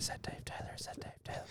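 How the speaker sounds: imitating girls whispering behind him; hushed gossip whisper. Girls on the stairs whispering about Dave.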